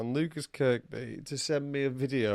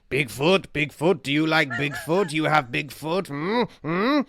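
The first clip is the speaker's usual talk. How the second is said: louder, rising questions poking fun; odd stranger teasing; 3 semitones higher, 5 dB louder than usual. Retold or imitated. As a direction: needle a stranger like a playful eccentric. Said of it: "He goes".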